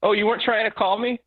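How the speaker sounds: imitating mom. Son voicing his mother on Skype.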